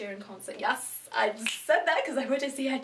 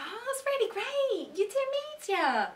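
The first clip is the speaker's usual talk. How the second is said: high, drawn-out mock enthusiasm; gushing family members; 14 semitones higher than usual. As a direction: gush in a high-pitched, stretched-out voice like an impressed relative. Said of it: "my family members are like".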